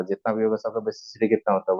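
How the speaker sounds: usual speaking voice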